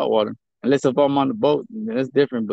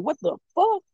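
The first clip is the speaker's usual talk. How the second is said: higher, stunned question; retold shock; 12 semitones higher, 3 dB quieter than usual. Telling how he reacted to his kids, higher and stunned. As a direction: throw it up in disbelief. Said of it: "I'm like".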